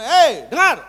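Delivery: loud high rebuking yell; loud commanding shout. Retold or imitated. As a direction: bark the command at full volume. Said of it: "saya teriak ini yang kedua".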